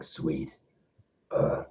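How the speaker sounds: low gravelly growl. Retold or imitated. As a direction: drop into the gravel. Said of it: "use my gravel".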